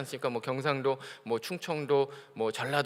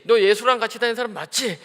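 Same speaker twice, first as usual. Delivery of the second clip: pressing accusation; 4 semitones higher, 6 dB louder than usual. Acted. Crowd presses Peter.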